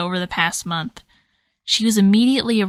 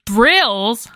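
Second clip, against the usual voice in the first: incredulous exclamation; 3 semitones higher, 5 dB louder than usual. A narrator's reading. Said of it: "Holly exclaimed".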